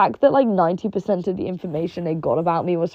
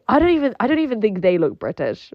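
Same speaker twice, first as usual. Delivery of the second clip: normal talking voice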